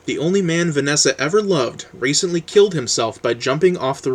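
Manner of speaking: animated book-jacket read, pitch lifting as it builds; blurb read-aloud. Acted. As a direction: read the publisher's pitch with lively emphasis.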